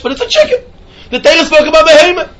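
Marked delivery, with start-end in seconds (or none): 0.0-0.6 squeaks it out through a laugh, much higher; a real laugh breaking into the punchline. Real, not acted. Joke about a wife as a chicken.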